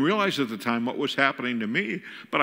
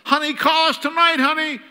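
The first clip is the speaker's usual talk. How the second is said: high, loud call after someone; retold yell; 13 semitones higher, 6 dB louder than usual. Father calling after his departing daughter, high-pitched yell. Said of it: "I yell".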